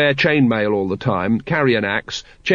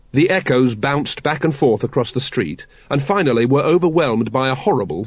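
usual speaking voice

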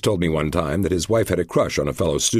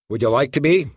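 FBI agent voice; 9 semitones higher, 4 dB louder than usual. Acted. Lesser agent asks.

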